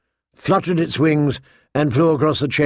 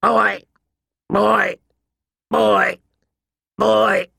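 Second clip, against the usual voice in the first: high shrill squawking raven; 6 semitones higher, much slower than usual. A narrator's reading. The raven mocking the boy, shrill squawks. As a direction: screech each word like a cackling bird. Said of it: "The raven laughed shrilly".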